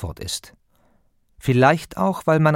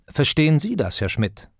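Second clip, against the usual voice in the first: Sie lifted higher, then dropping on Herr Schmidt; stress on Sie; 4 semitones higher than usual. Shown as a demonstration. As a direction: put the emphasis on Sie. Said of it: "Mit Betonung auf dem Sie". (mit deutlicher Betonung auf dem Sie)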